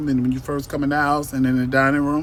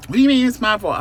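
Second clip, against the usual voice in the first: higher, louder indignant retort; 8 semitones higher, 5 dB louder, faster than usual. Retold or imitated. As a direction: push back, offended. Doctor retorts in retold argument.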